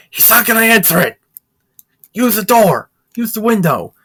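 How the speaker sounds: fast, high exasperated shouting; impatient yelling. Retold or imitated. Piccolo ordering the guy to break in, in a fast high shout. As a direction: bark the orders in a rush.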